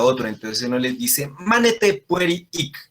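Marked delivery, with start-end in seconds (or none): 1.4-2.8 higher, slower call to stay put; calling running kids back, mock stern; scolding children. Teacher to class, playing a parent scolding kids. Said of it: "Cuando uno regaña a los niños".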